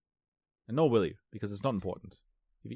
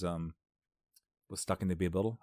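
usual speaking voice

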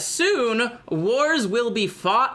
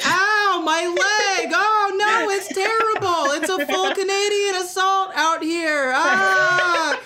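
hammed-up wounded German; 10 semitones higher, slower than usual. Faking a wounded German to scare the enemy, high mock wails. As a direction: ham up a panicked wounded soldier.

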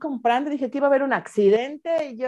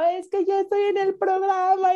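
high, imitated tearful wail; mock crying; 8 semitones higher than usual. Daughter leaving the family a voice message, mock crying. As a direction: re-enact blubbering through the news. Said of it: "Yo llorando".

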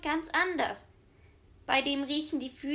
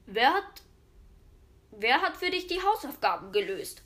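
louder stern teacher questioning; 6 dB louder than usual. A narrator's reading. The teacher questions Fritzchen about his homework, sternly. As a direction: demand an answer like a strict teacher. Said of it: "fragt der Lehrer streng".

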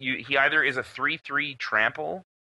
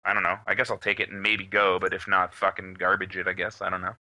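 indifferent mumble; 8 semitones lower than usual. Retold or imitated. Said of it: "you're just like".

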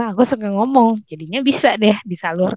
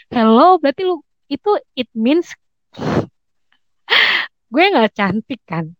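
sarcastic indignation; 6 semitones higher, 3 dB louder than usual. Retold or imitated. Retells indignant reaction to body shaming.

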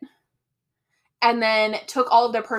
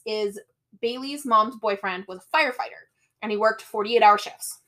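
ordinary talk

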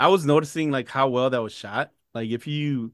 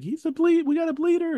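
mock panicked doctor. Retelling movie scene, doctors shouting.

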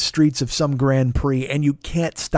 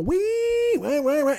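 high-pitched imitation of a squealing guitar; 12 semitones higher, 4 dB quieter, slower than usual. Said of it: "this big squeal".

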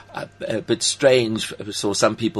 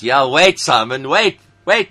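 loud, high, eager pleading; excited; 3 semitones higher, 7 dB louder than usual. Retold or imitated. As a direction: call out to stop Simon. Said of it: "I thought".

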